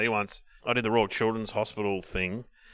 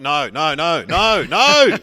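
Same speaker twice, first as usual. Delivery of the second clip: building from loud to a high shout; comic pleading, louder and higher each time; mounting dismay; 4 semitones higher, 10 dB louder, much slower than usual. Retold or imitated. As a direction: escalate each no in mock despair. Acted dismay as food goes past.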